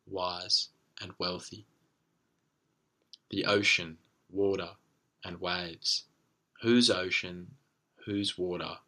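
measured spoken-word recital with deliberate pauses; slam poem. Real, not acted. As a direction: recite it as slam poetry, phrase by phrase. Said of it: "slam poem that I wrote".

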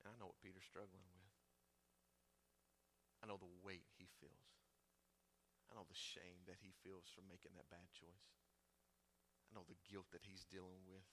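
soft, low, near-whispered compassion; tender Jesus. Pretend. Jesus gently sending word to Peter, soft and low. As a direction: speak gently of his shame. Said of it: "Jesus is like".

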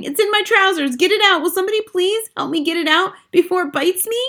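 high-pitched pleading panic; shouting. A narrator's reading. Cook pleads before the mouse bites, shouting. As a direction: beg frantically for help in a high voice. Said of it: "cook still shouting".

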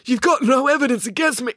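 high, choked protest breaking into a cough. Acted. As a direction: protest while fighting back a cough. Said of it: "coughed Maya".

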